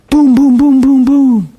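loud, high booming knocks, one after another; a loud mock knock-knock, boom after boom; imitated door pounding. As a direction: hammer out the knock on the door with your voice.

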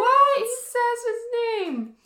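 very high, loud mock squeal; mock amazement. Host amazed Mister D used Percy's name, mock amazement. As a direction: squeal it out in exaggerated astonishment.

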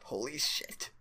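breathless unvoiced holy shit; frightened whisper. A narrator's reading. Old woman in the smoky hallway, whispering in fright. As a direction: gasp it out under your breath in fright. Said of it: "the old woman cried".

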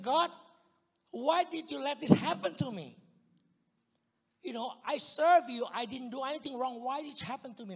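loud, high complaint to God; angry at God. Retold or imitated. A believer complaining to God after the robbery, loud and angry. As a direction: pour out the grievance, hurt and loud. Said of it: "I was so mad to God".

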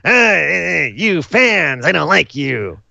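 slow, high, whiny shrieking rant, lightly done; lightly done Gilbert Gottfried voice; Gilbert Gottfried impression. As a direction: whine at the fans, slow and grating. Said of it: "Like a Gilbert Gottfried kind of thing".

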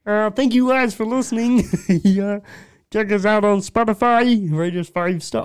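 high Kermit the Frog voice. Acted. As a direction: squeeze it up like Kermit. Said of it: "should I just do the Kermit voice".

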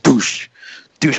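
sharp vocal thud, falling off; imitated door slam. Man retelling a stall door being rammed, imitated bang. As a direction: imitate a shoulder hitting a door. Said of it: "throwing his fucking shoulder".

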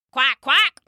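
a duck answering Jacob, quacking. Acted. Ducks quack.

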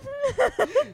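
high-pitched giggle. Real, not acted. Giggling fit over tongue twister.

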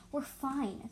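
ordinary delivery

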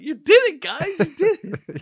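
loud, high gleeful cheer; hammed-up wide-eyed celebration; mock excitement. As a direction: congratulate them with over-the-top joy. Acts out Elf's coffee shop scene.

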